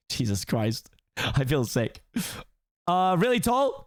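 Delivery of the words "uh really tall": cheeky Donkey. Acted. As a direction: blurt out a guess. Donkey guesses.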